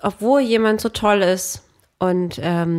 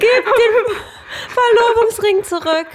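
high, loud, sobbing and laughing; fake-cry the demand, slowly; mock sobbing; 14 semitones higher, 4 dB louder, slower than usual. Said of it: "Reaktion vom Igel?".

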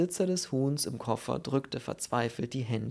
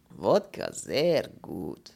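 very slow, swooping foreign voice; emphatic broken-German Pole; Polish character; 3 semitones lower, 5 dB louder, much slower than usual. Acted. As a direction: praise the drink with deep relish. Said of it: "bestätigte der Pole".

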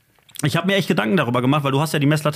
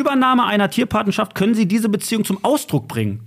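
mock official leaflet read, higher; reading a brochure aloud; 7 semitones higher than usual. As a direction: recite the leaflet line higher, half teasing. Reads a zoo brochure aloud.

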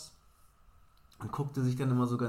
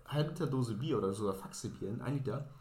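ordinary talk

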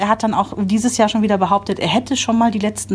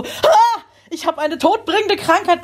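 mock alarm, pitched much higher with a laugh; mock panic; 9 semitones higher, slower than usual. After blowing green snot, mock panic. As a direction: play at being deathly ill, half laughing. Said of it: "denkt man erst so".

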